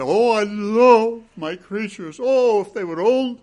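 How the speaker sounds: mock a lazy, sentimental love. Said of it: "God does not sit back sentimentally and say".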